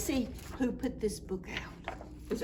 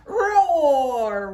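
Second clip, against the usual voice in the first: put-on louder playful dinosaur roar for a child; 4 semitones higher, 10 dB louder than usual. Froggy showing his dinosaur snowman, playful loud roar. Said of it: "went Froggy".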